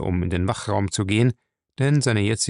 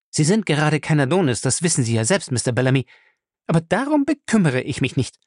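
put-on higher, lively young woman's voice, fast at times; spirited young wife; 6 semitones higher than usual. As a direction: complain with spirited indignation. Fay complains at length.

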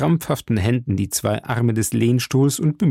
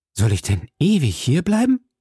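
alarmed question, pitched higher; touch of alarm; 5 semitones higher than usual. A narrator's reading. Milady asks her brother-in-law about her stay, mildly alarmed. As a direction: ask with restrained dismay, voice lifting. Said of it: "mit einem gewissen Schrecken".

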